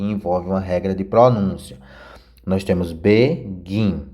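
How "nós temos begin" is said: "begin" devagar, com força no "gin"; tônica no gin. Shown as a demonstration. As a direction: segure e acentue o "gin" de "begin". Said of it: "O gin é mais forte". (acento forte em "gin" de "begin")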